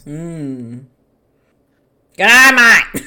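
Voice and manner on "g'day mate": loud, high, broad Aussie greeting; hammed-up Australian film villain; Mick Taylor. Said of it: "a family of Mick Taylors".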